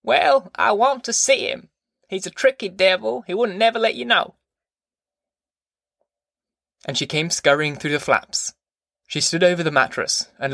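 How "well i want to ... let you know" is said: put-on high-pitched, peevish old grandma voice; sulking grandmother, pitched way up; sulky grandma. A narrator's reading. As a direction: complain in a high, put-on granny voice. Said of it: "Grandma answered sulkily".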